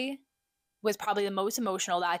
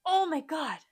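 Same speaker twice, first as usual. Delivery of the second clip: sudden realisation; 8 semitones higher, slower than usual. Real, not acted. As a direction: catch yourself as it hits you. Sudden realisation about changing name.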